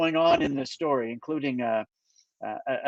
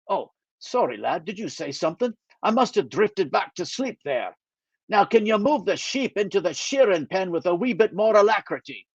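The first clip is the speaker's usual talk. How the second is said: sleepy Scottish teasing, pitched up; a dozy Scot pretending he nodded off; light Scottish brogue, mock drowsy; Scottish accent; 5 semitones higher than usual. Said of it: "he still has a Scottish accent".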